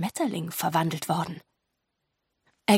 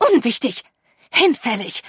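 loud, curt dismissal; hissed dismissal; 4 semitones higher, 6 dB louder than usual. A narrator's reading. Lady Lesso dismissing the girls' role, hissing. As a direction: cut them off with lightly hissed words. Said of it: "zischte Lady Lesso".

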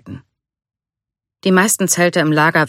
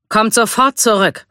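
higher-pitched commanding call; 7 semitones higher than usual. A narrator's reading. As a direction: order them back from a distance. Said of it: "rief er".

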